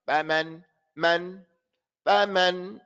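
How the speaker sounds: "faman" with both a's held open evenly; correct vowel length. Shown as a demonstration. Teacher corrects with No, it's faman, correctly pronounced. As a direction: stretch "faman" and "man" to full open vowels. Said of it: "No, it's".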